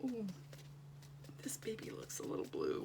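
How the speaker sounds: whispering to herself; hushed inner voice; whispered thought. Retold or imitated. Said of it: "and I'll think".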